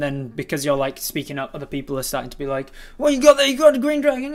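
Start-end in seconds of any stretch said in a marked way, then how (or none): 3.0-4.3 very high, loud yelling; shouting townsfolk. Acted. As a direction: heckle over the crowd. Crowd members shouting over.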